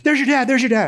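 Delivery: teasing friend. Retold or imitated. Friend teasing him at the food court, mock alarm. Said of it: "He's like".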